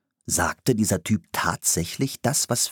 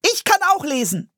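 put-on very high, loud girlish insistence; eager girl squeaking for attention; a bit shrill; 5 dB louder than usual. A narrator's reading. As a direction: pipe up shrilly. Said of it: "ihre Stimme wurde ein bisschen schrill".

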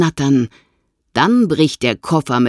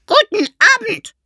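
much higher, squawking parrot voice; croaking parrot; 4 dB louder than usual. A narrator's reading. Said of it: "krächzt der Papagei".

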